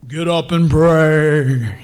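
slow, low, booming mock-divine voice; God. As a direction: draw it out deep and loud, hammed-up almighty. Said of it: "he doesn't talk like that".

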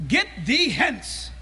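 slow, lofty archaic command; mock King James Jesus. Preacher imagining Jesus speaking Old King James, slow archaic command. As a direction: draw it out like scripture. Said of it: "Did he speak in Old King James?".

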